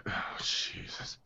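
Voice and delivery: breathless, slow whisper. Acted. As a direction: barely breathe the words out. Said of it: "just be like".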